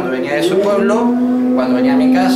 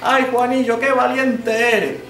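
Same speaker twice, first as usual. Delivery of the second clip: lightly voiced boy and weeping mother, higher; lightly higher character voices; 9 semitones higher than usual. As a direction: act the dialogue for children.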